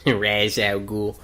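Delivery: Ra's al Ghul drawn out slowly as "Raz al Gool"; slow mock wrong Ra's, with z instead of sh; mock mispronunciation "Raz". Shown as a demonstration. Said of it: "not Raz".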